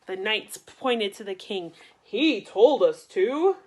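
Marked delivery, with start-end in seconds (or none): 2.0-3.6 loud, slow protest; blaming cry. Acted. Knights blaming the king, loud slow cry. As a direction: knights pointing at the king, crying out. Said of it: "they cried".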